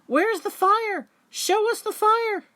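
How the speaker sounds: high, loud excited crowd calling out; eager animals. Acted. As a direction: clamour for the fire, voices pitched high.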